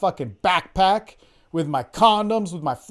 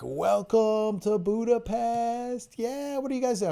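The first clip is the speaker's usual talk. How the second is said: higher, very slow silly sing-song; mock goofy greeting; 7 semitones higher, 4 dB quieter, slower than usual. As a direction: ham up the clueless friend leaning out. Mocking friend opening the window.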